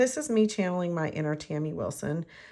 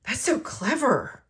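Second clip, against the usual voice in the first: slow, high, amazed imitation of husband; impressed husband; 5 semitones higher, 4 dB louder than usual. Husband praising her trick, slow and amazed. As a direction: stretch it out like he's dazzled. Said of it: "he was like".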